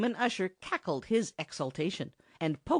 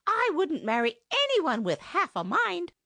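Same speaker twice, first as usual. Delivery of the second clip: indignant high retort; 12 semitones higher, 4 dB louder than usual. Acted. Lady retorts to the ogre.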